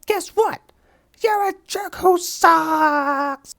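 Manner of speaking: slow, loud, high mocking yell; loud taunt. Hero shouts a slow taunt at the villain. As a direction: drag out the insult at full volume.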